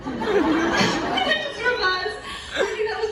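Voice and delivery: slowed down by real laughter; giggling out the punchline; laughing. Real, not acted. Laughing while telling the story.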